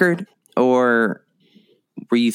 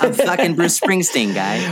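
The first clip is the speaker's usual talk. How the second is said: higher-pitched mock Boston accent; 7 semitones higher than usual. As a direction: echo it in a hammed-up Boston accent. Echoes in the Boston voice again.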